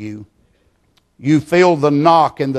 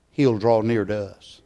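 ordinary delivery

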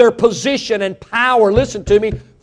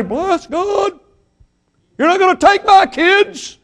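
much higher, outraged parent; indignant parent; 10 semitones higher than usual. Retold or imitated. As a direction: protest like a parent defending kids.

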